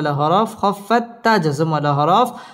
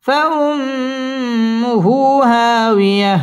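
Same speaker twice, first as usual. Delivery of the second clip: higher, very drawn-out فأمه; high recitation; 4 semitones higher, much slower than usual. Shown as a demonstration. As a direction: stretch فأمه high then drop. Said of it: "wow ঠোঁট গোল করে উচ্চারণ করতে হবে". (উঁচু সুরে টেনে তিলাওয়াত)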